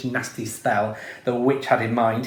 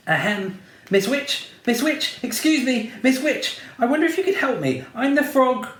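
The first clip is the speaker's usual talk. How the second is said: prince voice; 9 semitones higher than usual. A narrator's reading. Prince pleads to third witch.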